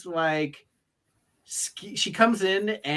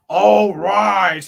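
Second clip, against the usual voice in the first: louder, imitating a deep booming command; Damian Priest voice; 4 semitones higher, 10 dB louder than usual. Fan imitating Damian Priest's entrance call, louder mock boom. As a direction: summon the room to its feet. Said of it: "He's got that deep voice".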